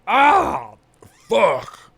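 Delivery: high, pained grunt of disgust; mock wince. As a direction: choke on a harsh shot. Reacting to a bad shot.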